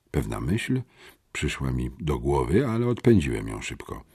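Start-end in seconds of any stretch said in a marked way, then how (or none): none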